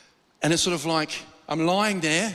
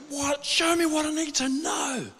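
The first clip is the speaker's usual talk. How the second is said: high, strained cry without full volume; imitated screaming plea; 9 semitones higher than usual. Man in his old house pleading with God, imitated scream. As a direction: cry out desperately, pitched way up and held back in level. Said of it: "I'm screaming at God".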